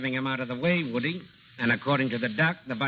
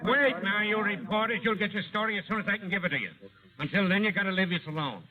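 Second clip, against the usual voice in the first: raised, higher voice over a crowd; fending off reporters; 7 semitones higher than usual. Acted. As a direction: hold back the reporters, firmly.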